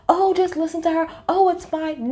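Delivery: high, breezy brush-off; mock dismissive. Imitating Jenny and Lisa brushing it off, high and breezy. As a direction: wave it away lightly. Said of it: "both like".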